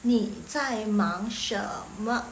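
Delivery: very slow, syllable by syllable; slow teaching model. Shown as a demonstration. Teacher modelling a question for learners, slowly. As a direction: draw out each word separately.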